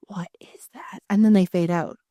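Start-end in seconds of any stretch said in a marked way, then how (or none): 0.1-1.0 breathless whispered question; horrified whisper. Retold or imitated. Toys whispering in horror at the new tablet. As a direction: gasp then whisper it in dread.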